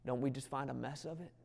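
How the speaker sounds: normal talking voice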